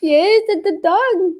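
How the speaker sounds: high, slow parent voice. Real, not acted. Answering a small child.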